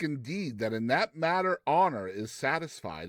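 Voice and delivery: ordinary delivery, nothing marked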